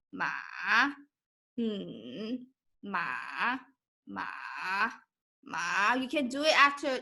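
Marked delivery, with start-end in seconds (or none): 0.1-5.9 MA and HMM dropped low in the voice; low, soft MA dipping down; third tone. Shown as a demonstration. Teacher models third tone, going low. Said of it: "Go low".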